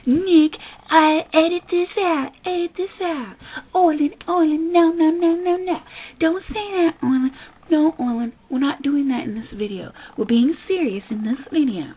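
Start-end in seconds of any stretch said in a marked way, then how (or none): 0.1-9.3 higher, louder mock fussing; mock scolding. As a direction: scold Orlin in a fussy imitation. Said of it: "when you go".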